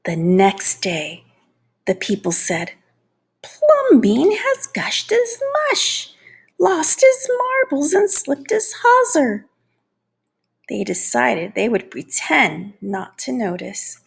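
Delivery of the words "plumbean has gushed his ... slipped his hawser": scandalized gossip. Acted. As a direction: gasp it out like shocked busybodies. People gossip again.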